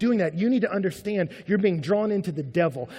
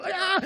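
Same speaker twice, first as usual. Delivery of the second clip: high-pitched mock squeal of delight; hammed-up self-adoring cry; ecstatic "ah". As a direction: exclaim as if basking in applause. Basking in imagined praise.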